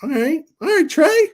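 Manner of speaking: high squeaky "all right, Trey" rising up; high-pitched, sarcastic impressed reaction; mock impressed. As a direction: squeak it out in fake awe. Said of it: "I'm like".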